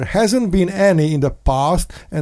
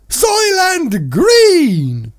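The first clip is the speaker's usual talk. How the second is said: dramatic warning; 12 semitones higher, 4 dB louder, much slower than usual. Real, not acted.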